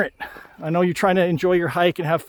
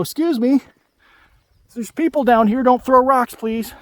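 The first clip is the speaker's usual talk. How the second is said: put-on raised voice calling up to the kids, softening on please; mock-calling up the cliff, louder on don't throw rocks; retold call-out; 6 semitones higher, 4 dB louder than usual. Hiker calling up to kids throwing rocks, a retold raised warning. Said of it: "speak up and go".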